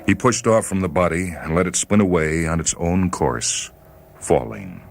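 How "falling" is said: ordinary delivery, nothing marked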